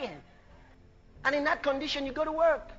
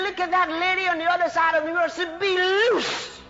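preached command; 5 semitones higher, 4 dB louder than usual. Acted. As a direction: call it out high, stretching the final words.

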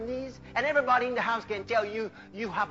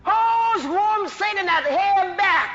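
rising into a loud preacher's cry; full-force preaching declaration; 4 semitones higher, 5 dB louder than usual. Shown as a demonstration.

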